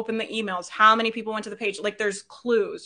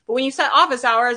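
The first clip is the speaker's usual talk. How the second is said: ordinary talk, nothing marked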